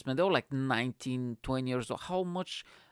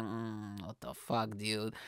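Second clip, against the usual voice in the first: low-volume judging murmur; muttered disbelief; 3 semitones lower, 8 dB quieter, slower than usual. Retold or imitated. Audience glancing at the failed comedian, quiet muttered judgement. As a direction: mutter it under your breath as an onlooker.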